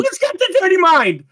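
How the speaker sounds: very high-pitched, held-out teasing cry; teasing call-out. Real, not acted. Teasing a fellow player over a dirty mind, high-pitched call-out.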